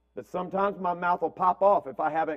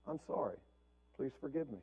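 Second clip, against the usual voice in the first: much softer, sincere apology; 4 semitones lower, 15 dB quieter, faster than usual. Retold or imitated. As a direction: say sorry gently, humbly. Parent apologizing to daughter.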